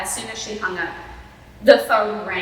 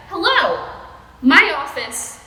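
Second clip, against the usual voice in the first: lightly playful, higher and louder; a playful phone greeting, pitched up; joking; 7 semitones higher, 6 dB louder, slower than usual. A narrator's reading. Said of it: "joked Doctor Horton".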